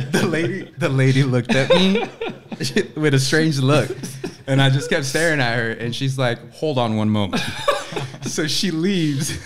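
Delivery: telling it through laughter; can barely get it out for laughing. Real, not acted. Tells the story while laughing.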